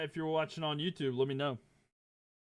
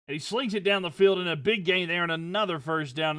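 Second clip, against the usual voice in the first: loud, much higher big-gain call; excited play call; 5 semitones higher, 7 dB louder than usual. Acted. Calling a big gain for the stream, loud and thrilled. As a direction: shout the catch to the crowd.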